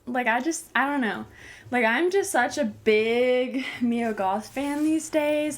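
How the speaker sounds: ordinary talk, nothing marked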